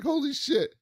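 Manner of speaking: high, startled gasp of a curse; mock shock. Retelling Ron seeing Jamie nearly hit the truck, high mock shock. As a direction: blurt it at a near crash.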